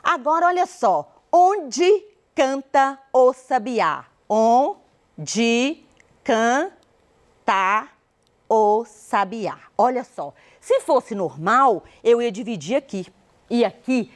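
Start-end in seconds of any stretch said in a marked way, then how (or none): 1.3-4.0 measured, word by word; slow, deliberate reading. Shown as a demonstration. Teacher showing the next verse to divide, slow and deliberate. 4.3-9.6 very slow, each syllable held apart. Shown as a demonstration. Teacher dividing the verse the normal way, syllable by syllable.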